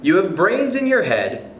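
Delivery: usual speaking voice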